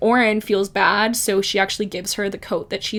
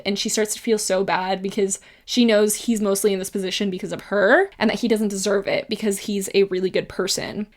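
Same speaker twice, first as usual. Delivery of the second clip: usual speaking voice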